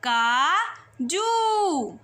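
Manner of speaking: काजू with a long, slow ऊ; drawn-out काजू; stretched vowel. Shown as a demonstration. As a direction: show how the ऊ stretches in काजू. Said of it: "कैसे लंबा स्वर खिंच गया".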